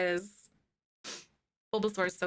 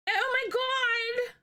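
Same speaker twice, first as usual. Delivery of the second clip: high, slow, drawn-out gasp of shock; shocked realisation; much slower than usual. Real, not acted. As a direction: stretch it out as it hits you. Sudden realisation about birthday.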